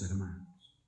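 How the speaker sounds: ordinary talk, nothing marked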